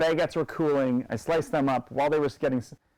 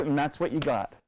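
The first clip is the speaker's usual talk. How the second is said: ordinary talk, nothing marked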